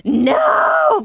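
long, high wail of despair; anguished mock cry. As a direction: cry out as if all is lost. Retold reaction to dropped call.

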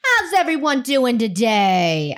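slow, hammed-up crowd greeting; milk the hello like a cheesy host; over-the-top presenter. Shown as a demonstration. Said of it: "we can do better than that".

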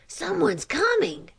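usual speaking voice

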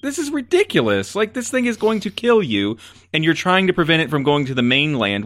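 high-pitched, incredulous ranting; exasperated rant. Real, not acted. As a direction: let the pitch leap up in exasperation. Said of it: "That's my rant".

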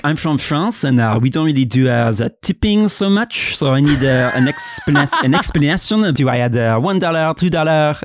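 put-on a hinted French tourist accent, asking innocently. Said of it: "Can I get a taste of what you would say?".

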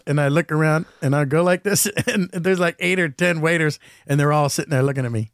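normal talking voice

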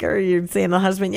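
usual speaking voice

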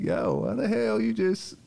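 high, slow retold disbelief; amused bafflement. Questioning her after the apology, high baffled protest. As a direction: pitch it up and stretch it, nearly laughing.

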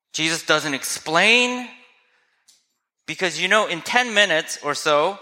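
ordinary talk

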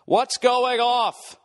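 high, slow, stiff cop voice. Retold or imitated. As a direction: stretch it out like an uptight TV cop.

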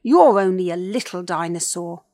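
big, very loud taunt; blustering dinosaur bully; mocking villain. A narrator's reading. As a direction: scoff loudly at the little one. Villain mocks the tiny hero.

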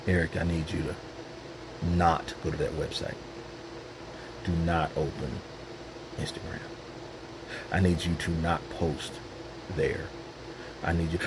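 low, soft, gentle voice of God; voicing God. God gently nudging the believer to pray, low and soft. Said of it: "when the Lord is talking like that".

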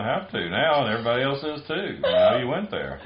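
fast, laughing through the words. Real, not acted. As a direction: chuckle out the words. Laughing at teabag joke.

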